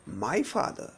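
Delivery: "My" held and rising; single out "My"; stress on MY. Shown as a demonstration. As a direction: lean on "My", soft "father". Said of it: "If I stress this word".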